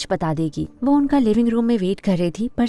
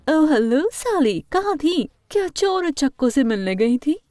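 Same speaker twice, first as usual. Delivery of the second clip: taunting; 5 semitones higher than usual. Acted. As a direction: taunt her with false sweetness, swooping high. Villains lie in wait, taunting Sally. (ऊँचे सुर में ताना मारते हुए)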